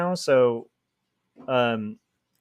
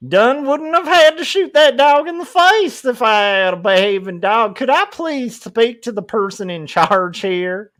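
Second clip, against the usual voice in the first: higher, louder entitled customer voice; mock Karen; 7 semitones higher, 5 dB louder than usual. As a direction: demand the manager, haughtily. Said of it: "a gun-toting Karen".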